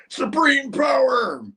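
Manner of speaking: high, slow hammed-up villain shout. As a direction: bellow it like a cartoon villain. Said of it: "He's like".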